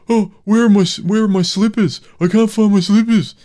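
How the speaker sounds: high, loud whiny-dumb mock voice; mock a clueless husband, loud and high-pitched; stupid husband voice. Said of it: "she puts on this really stupid voice".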